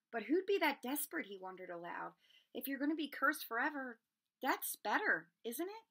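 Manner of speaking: put-on a boy thinking aloud, confused; puzzled boy.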